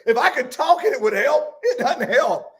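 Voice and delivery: Job's lament broken by laughter; chuckling, half-amused voicing of Job; laughing as Job. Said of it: "Job would say it like that".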